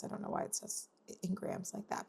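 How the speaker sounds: whispered under the breath; quick whispered aside to herself; muttered aside. Real, not acted.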